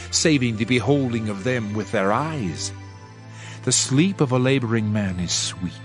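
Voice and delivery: usual speaking voice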